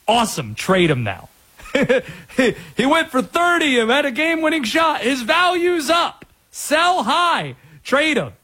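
worked-up sports take, pitched high; high, urgent mock excitement; excited reaction. Radio host replays his reaction to the game-winner, excitedly. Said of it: "my initial reaction was".

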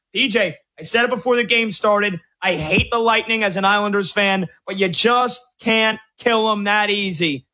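ordinary delivery

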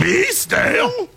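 high, slow indignant squeal; mock offended. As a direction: act hammed-up outrage. Mocking friend's offended reply.

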